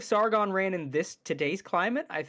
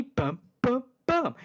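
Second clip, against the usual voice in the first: each "bump" pitched up and set apart, then dropping; rising tone, falling emphasis; 4 semitones higher, slower than usual. Shown as a demonstration. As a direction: demonstrate the tune with separate, punched "bump"s. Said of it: "rising tone down to a fall".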